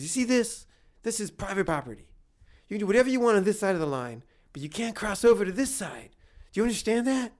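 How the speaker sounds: high-pitched, emphatic mock telling-off; stern lecturing. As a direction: lay down the law as an indignant stranger. Acted man laying down rules.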